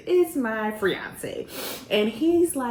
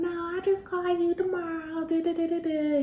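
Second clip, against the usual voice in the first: mocking brush-off; 10 semitones higher than usual. Mocks her own brush-off reply.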